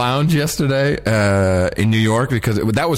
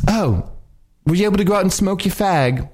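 lightly put-on higher voice; a hint of a grand star's chatter; Joan Collins; 6 semitones higher than usual. Joan Collins to her companions, lightly imitated. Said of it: "Here's the conversation".

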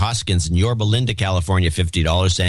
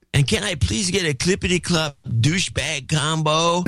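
higher, dopey stoner dude; 4 semitones higher than usual. Shown as a demonstration. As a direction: read it like a stoned surfer dude. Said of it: "read the rest of this in the stoner dude voice".

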